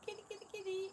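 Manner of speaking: high-pitched, soft kitty call; calling a cat. Real, not acted. Owner calling the cats over, high sing-song call. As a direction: coo for the kitty in a high little voice.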